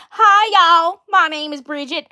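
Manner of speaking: loud, high character voice; Bridget. Shown as a demonstration. Streamer demonstrating a character introducing herself, loud high voice. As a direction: shout a high-pitched, y'all-style hello. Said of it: "so for her voice, she goes".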